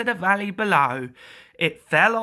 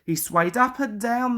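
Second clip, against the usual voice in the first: ordinary delivery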